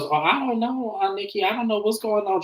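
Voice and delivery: high-pitched confused imitation; Jermisha. Confused woman answering her aunt, high clueless voice.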